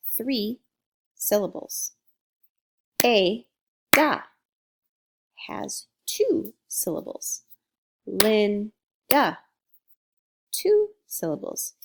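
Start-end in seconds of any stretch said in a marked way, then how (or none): 3.0-4.2 A-da broken into two separate beats, louder; split into syllables. Shown as a demonstration. Teacher clapping out a name, split into syllables. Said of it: "figure out syllables by clapping". 8.0-9.4 Linda broken into Lin, then da; split into syllables. Shown as a demonstration. Teacher counting syllables for students, split into beats. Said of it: "figure out syllables by clapping".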